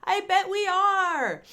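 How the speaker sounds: high, slow, drawn-out tease; high slow sing-song reply; playful sing-song. Real, not acted. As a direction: stretch it out playfully. Teasing answer to own question.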